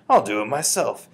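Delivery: loud, spirited insistence; mildly exclaimed; crying out. A narrator's reading. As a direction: insist with plenty of volume. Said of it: "she cried".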